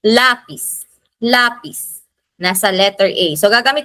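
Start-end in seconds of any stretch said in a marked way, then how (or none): 0.0-2.0 "Lapis" said LA-pis, the A stressed; "Lapis" twice, each stressed on La; stress on first syllable. Shown as a demonstration. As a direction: hit the La of "Lapis", let pis fall away. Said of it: "ang stress nito ay nasa A".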